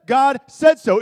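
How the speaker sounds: emphatic punchline. Shown as a demonstration. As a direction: proclaim "God said so" with full force. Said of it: "brilliant theological point".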